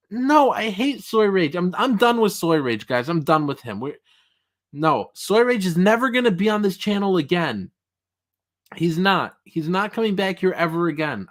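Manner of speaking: hammed-up jilted outrage; mock breakup rant. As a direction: pretend you're done with him forever. Fake feud with co-host.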